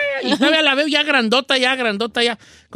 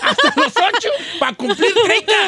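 said through real laughter, high; high, laughing confession; 10 semitones higher than usual. Real, not acted. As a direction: laugh it out as you repeat it. Laughing at own joke.

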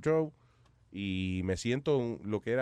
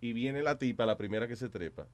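normal talking voice

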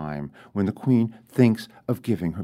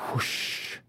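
breathy whispered whoosh, dying away; 9 dB quieter than usual. A narrator's reading. Narrator letting the queen's thought vanish, whispered.

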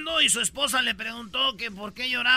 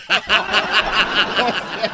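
big burst of laughter; loud laugh; 9 dB louder than usual. Real, not acted. Reporter laughs at own joke.

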